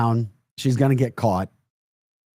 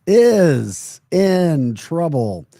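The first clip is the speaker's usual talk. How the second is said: drawn out; 5 semitones higher, 6 dB louder, much slower than usual. Acted. Reading reply dramatically.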